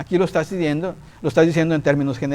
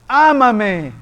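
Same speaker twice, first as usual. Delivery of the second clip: a touch higher, a hint of a commanding Lord; lightly voiced divine command, raised up; the Lord urging him, mildly higher; 5 semitones higher, 4 dB louder than usual. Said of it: "me dice".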